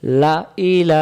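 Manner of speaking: লা ইলা drawn out slowly; slow recitation. Shown as a demonstration. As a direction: stretch লা ইলা by one alif. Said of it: "এক আলিফ টানতে হয়". (লা ইলা ধীরে টেনে পড়া)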